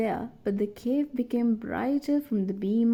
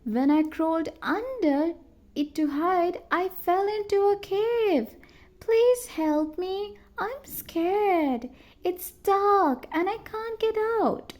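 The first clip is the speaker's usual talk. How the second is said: scared; 9 semitones higher than usual. A narrator's reading. Scared girl trapped in cave.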